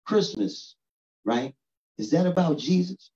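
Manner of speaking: put-on high, wondering little-girl voice; a light take on his young daughter; curious child. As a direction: ask it like a curious little kid. Six-year-old daughter asks question.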